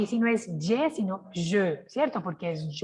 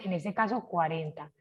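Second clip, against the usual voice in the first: ordinary talk, nothing marked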